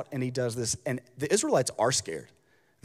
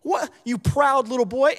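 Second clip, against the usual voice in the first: angry big brothers; 9 semitones higher, 4 dB louder than usual. Retold or imitated. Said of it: "They say".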